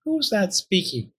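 high, startled question; surprised. A narrator's reading. Fox hearing an unknown voice, surprised. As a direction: ask it taken aback, pitch up. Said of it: "asked the surprised fox".

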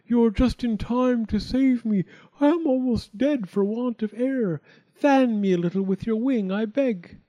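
high, urgent storybook plea; pleading fire. A narrator's reading. Fire begging the chick for air, desperate. Choked fire begs for help.

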